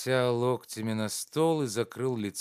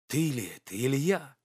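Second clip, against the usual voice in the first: put-on hurt reproach to an old friend; reproachful; 2 semitones higher than usual. A narrator's reading. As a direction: chide him in disbelief. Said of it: "упрекал он". (с упрёком)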